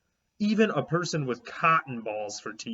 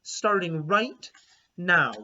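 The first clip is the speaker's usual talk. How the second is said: slow, higher, each word weighed; solemn pledge; 5 semitones higher, 6 dB louder, slower than usual. A narrator's reading. Clementine vows to the chickens, slow solemn pledge. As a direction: swear it like an oath, slowly. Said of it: "with my pledge hand over my heart".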